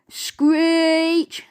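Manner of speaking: high, drawn-out "screech"; sound-effect screech; screeching brakes. A narrator's reading. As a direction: stretch the word like skidding tyres.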